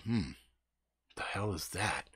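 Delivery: low, hushed self-questioning; wary muttering. Retold or imitated. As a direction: mutter it softly, as if someone outside might hear. Hears scraping outside, alone, wary.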